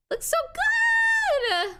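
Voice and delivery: high, loud delighted squeal; thrilled high shout; excited shout. Real, not acted. Opening a gift of fan art.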